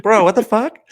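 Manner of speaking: high, loud disbelieving yell; mock outraged shout; incredulous shout. Friends yelling in disbelief.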